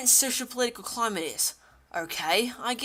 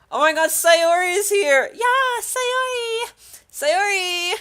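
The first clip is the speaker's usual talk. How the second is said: high, loud squealing welcome; gleeful high shout; excited cheer; 9 semitones higher, 8 dB louder, slower than usual. Real, not acted. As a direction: cheer the newcomer's name, drawn out. Greeting Sayori's arrival excitedly.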